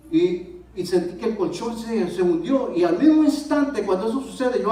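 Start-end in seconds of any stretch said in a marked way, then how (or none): none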